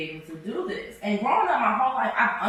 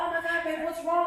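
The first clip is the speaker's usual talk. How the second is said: worried; 9 semitones higher than usual. Retold or imitated. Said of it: "running to her".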